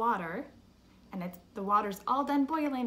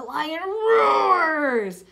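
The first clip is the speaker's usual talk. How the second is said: very loud, higher, long-drawn roar on the last word; lion roar; 7 semitones higher, 13 dB louder than usual. Shown as a demonstration. Teacher asks children about a lion, roaring the word. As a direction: roar out "roars" slowly like a lion.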